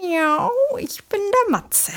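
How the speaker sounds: Katzenstimme. Acted. Matze stellt sich vor.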